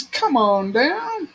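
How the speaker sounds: high, slow, drawn-out host call; game show host call. Pretend. Calling Gibbons like game show.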